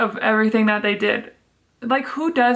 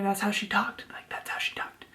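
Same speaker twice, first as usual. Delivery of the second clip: hushed, voiceless insistence; barely audible whisper; whispered aside; 11 dB quieter than usual. Real, not acted. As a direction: drop to a whisper, as if confiding. Hushed aside after the imitation.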